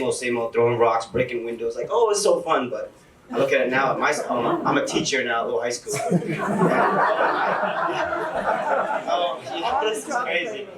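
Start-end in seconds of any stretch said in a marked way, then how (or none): 6.0-9.0 laugh. Real, not acted. Laughs at own story.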